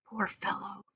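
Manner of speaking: breathy unvoiced whisper in the ear. A narrator's reading. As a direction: lean in and whisper the secret. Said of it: "whispering in James' ear".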